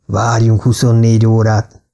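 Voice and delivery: plain reading voice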